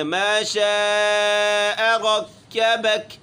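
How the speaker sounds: slow, with a nasal مَّا and a long شَاءَ; drawn-out شَاءَ; ghunna and long shaa. Shown as a demonstration. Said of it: "شاہ کو کھینچنا ہے".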